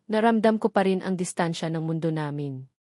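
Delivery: plain reading voice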